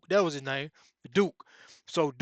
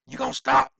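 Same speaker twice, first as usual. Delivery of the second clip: much higher and louder, nearly yelled; shouted demand; 9 semitones higher, 8 dB louder than usual. Retold or imitated. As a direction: yell it at him to make him quit. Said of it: "you can hear Ken saying".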